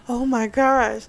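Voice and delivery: a touch of flustered delight; mock flustered. Woman reacting to his teasing threat, high flustered gasp.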